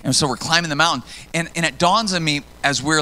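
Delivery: normal talking voice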